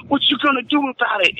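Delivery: high, fast taunting dare; tough challenge. Retold or imitated. Bike guy daring the security guard, fast taunting challenge. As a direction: throw down a street challenge. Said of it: "he goes".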